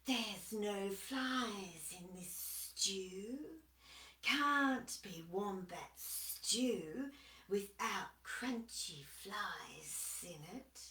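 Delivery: slow, soft sly lizard; hissing. Acted. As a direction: ease the words out lazily. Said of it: "he hissed".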